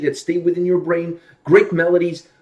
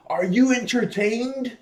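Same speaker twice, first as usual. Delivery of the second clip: drawn-out dramatic gladiator challenge, pitched up; slow, high theatrical call; [name]; 6 semitones higher, 4 dB quieter, much slower than usual. Acted. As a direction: stretch it out like a challenge to a crowd. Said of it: "is [name] going".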